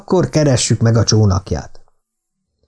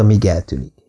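usual speaking voice

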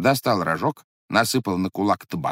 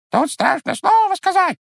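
very high, loud piercing squeak; tiny bird shrieking an echo; squeaky bird's beak; 5 dB louder than usual. A narrator's reading. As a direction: parrot it back in a bird's squeak. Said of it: "пискнул птичий нос".

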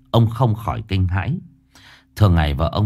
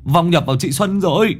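mock alarm; 6 semitones higher than usual. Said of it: "Dương Đông rú lên".

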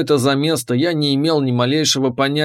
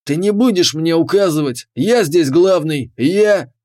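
yelling; 3 semitones higher, 3 dB louder than usual. A narrator's reading. Gang leader keeps yelling.

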